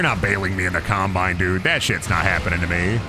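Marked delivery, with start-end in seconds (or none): none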